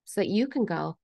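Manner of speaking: normal talking voice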